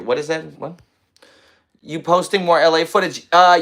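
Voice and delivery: ordinary talk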